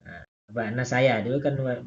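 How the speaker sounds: ordinary talk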